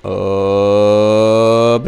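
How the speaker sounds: long, gliding "hooop"; drawn-out hop. Shown as a demonstration. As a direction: stretch "Hop" to follow a rising pencil stroke. Sound effect for upward pencil stroke.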